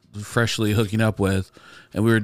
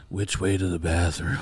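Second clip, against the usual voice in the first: low, softer, mock-seductive classroom phrase; mock deep voice; 5 semitones lower, 5 dB quieter than usual. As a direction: drop into a put-on deep bedroom voice. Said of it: "like, deepest voice".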